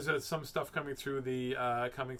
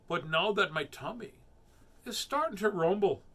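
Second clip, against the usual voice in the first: higher, louder bragging build; triumphant little mouse; bold mouse; 6 semitones higher, 7 dB louder than usual. Acted. Mouse turning on the Gruffalo, loud bold voice.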